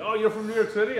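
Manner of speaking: lightly voiced keen stranger; eager stranger. Stranger to visitor from New York, mildly eager.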